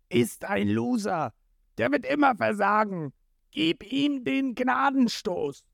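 high, strained, scornful tyrant; gloating villain. A narrator's reading. Krieg mocking Jin to his crew, high villainous gloating. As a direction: sneer at the loser, rising toward the final order.

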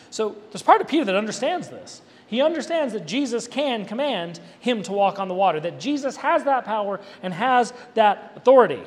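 ordinary talk

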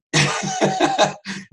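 loud, high, hearty laugh; burst of laughter. Real, not acted. Preacher laughing at his own joke about the child, loud.

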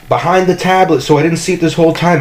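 high, fast indignant complaint. Real, not acted. Complaining to Jamie about the hidden coffee, high and fast.

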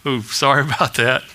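a small, low, sheepish sorry; mildly sheepish and apologetic; sheepish apology. Acted. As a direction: own up with an embarrassed little chuckle. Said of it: "look at Jesus kinda sheepishly and go".

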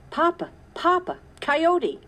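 put-on high, loud boyish yell; shouted alarm. A narrator's reading. Caleb yelling to Papa about the coyote, loud alarm. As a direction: cry out the warning at full voice. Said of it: "shouted Caleb from outside".

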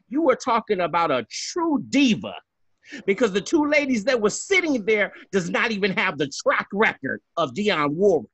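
high-pitched, indignant diva rant; Fernando. Acted. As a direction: scold emphatically, driving each point home. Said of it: "I'm gonna be Fernando for a second".

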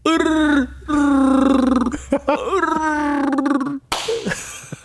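long high rising laugh; real laugh, high and rising. Real, not acted. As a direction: laugh helplessly. Laughing at assigned voice.

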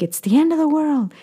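mock a harsh immigrant parent's scolding. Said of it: "very, very harsh".

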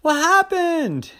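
high, loud, slow panicked yell; yelling boy. Acted. Terry calls into the dark house, high panicked yell. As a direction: cry out into the dark. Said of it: "he yelled".

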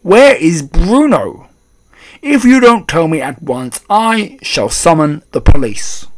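loud, slow, threatening demand; angry cry. A narrator's reading. Father threatening grandmother with police, crying out angrily. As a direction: raise pitch and volume, stretch the words. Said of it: "cried Mister Jenkins".

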